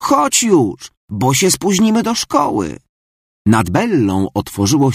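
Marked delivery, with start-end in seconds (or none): 0.0-2.8 pitched high and pressing; urgent high call. Acted. Bella calls to Marta to hurry, high and urgent. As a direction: hurry her friend along. Girl calls through door.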